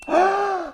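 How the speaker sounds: high, sudden gasp of surprise; a quick shocked intake of breath; sharp gasp. Real, not acted. Reacting to answers coming in.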